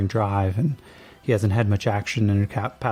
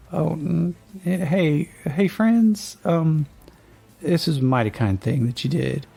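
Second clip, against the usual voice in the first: grateful rancher; 7 semitones higher than usual. Acted. Grateful rancher thanks the travelers.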